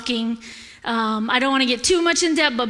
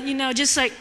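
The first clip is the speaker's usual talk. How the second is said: ordinary delivery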